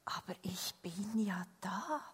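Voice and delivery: very soft, hushed repeat; tender near-whispered punchline; gentle reassurance. A narrator's reading. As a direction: let it sink in quietly. Story's punchline repeated softly.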